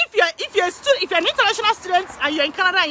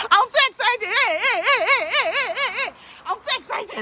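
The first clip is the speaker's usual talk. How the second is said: higher, cheering hey hey, laughing through it; giddy excitement; 5 semitones higher than usual. Real, not acted. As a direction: bubble over with real excitement.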